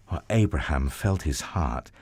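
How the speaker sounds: usual speaking voice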